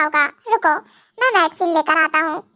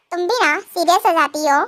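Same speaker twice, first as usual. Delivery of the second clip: usual speaking voice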